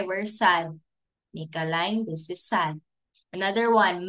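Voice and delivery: usual speaking voice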